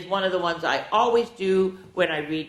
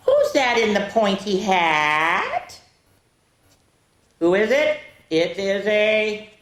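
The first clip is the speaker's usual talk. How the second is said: put-on reading to children; 6 semitones higher, 5 dB louder, much slower than usual. Reading riddles to kids.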